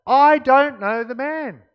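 high, loud, drawn-out denial; escalated, emphatic protest at full volume; vehement sworn denial. A narrator's reading. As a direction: swear it forcefully, stretching each word. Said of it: "he began to call down curses".

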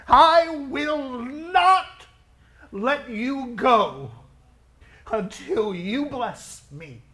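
slow, loud, pleading cry of Jacob; cling and refuse to let go; desperate cry. Acted.

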